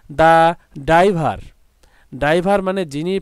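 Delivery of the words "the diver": very slow, a bit louder on "diver"; slow model sentence. Shown as a demonstration. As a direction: read it slowly for the class. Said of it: "make sentence করতে পারি".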